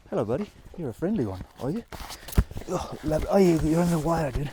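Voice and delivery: high, sing-song pet voice; talking to a dog. Real, not acted. Greeting a friendly dog.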